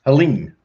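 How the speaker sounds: "Helene" as hel-EEN, falling at the end; practised name pronunciation. Shown as a demonstration. Designer practising a client's name to remember it, "Helene" as hel-EEN. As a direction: say "Helene" carefully with a long ee. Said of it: "now I'll never forget".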